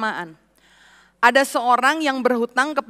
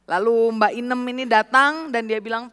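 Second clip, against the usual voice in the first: normal talking voice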